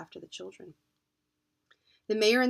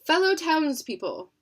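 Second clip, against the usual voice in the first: speech opening; 8 semitones higher, 8 dB louder than usual. Acted. Mayor opens a speech.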